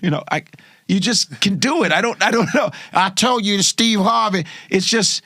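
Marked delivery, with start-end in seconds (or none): none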